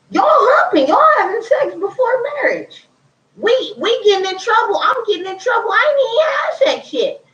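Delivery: high-pitched outrage at being blamed; exasperated outburst. Retold or imitated. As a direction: squeal it in disbelief at the cousins. Indignant outburst about family trouble.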